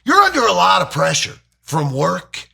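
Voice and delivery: earnest fake-concerned lecture, rising high; preachy anti-drug adult. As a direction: lecture the kid about drugs. Acted anti-drug cop sketch.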